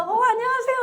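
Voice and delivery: high, bright, laughing 안녕하세요; sudden polite greeting. Retold or imitated. Sponsor suddenly greeting a newcomer, chirpy and polite. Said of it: "이래요".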